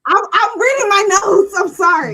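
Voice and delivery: loud, insistent explanation. Real, not acted. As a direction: protest over the interruption. Repeats apology over an objecting panelist.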